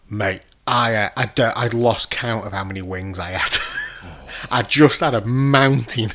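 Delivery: usual speaking voice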